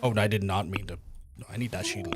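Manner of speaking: usual speaking voice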